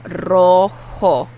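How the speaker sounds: Rojo with the J breathed like an English H. Shown as a demonstration. Teacher showing the J sound, breathy H. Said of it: "the J is pronounced like an H".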